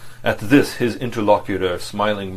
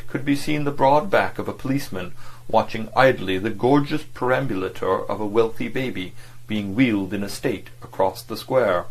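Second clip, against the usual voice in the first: usual speaking voice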